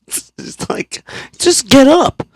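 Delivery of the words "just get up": high, loud imitation of an impatient partner; exasperated mock command. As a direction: order it sharply, mocking a fed-up partner. Partner's order retold.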